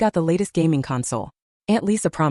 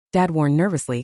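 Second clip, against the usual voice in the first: ordinary delivery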